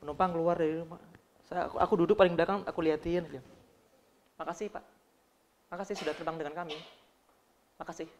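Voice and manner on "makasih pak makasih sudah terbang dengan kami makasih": mumbled rote thanks. Retold or imitated. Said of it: "salah mengucapkan kata terima kasih".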